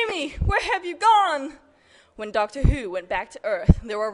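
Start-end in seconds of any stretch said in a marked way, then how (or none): 0.0-1.6 high, loud mock-distraught call; desperate calling out. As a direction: call out high for a lost friend. Doctor calls for missing Amy.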